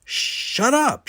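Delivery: high, sharp yell to silence him; angry shout. A narrator's reading. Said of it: "shouted Mark".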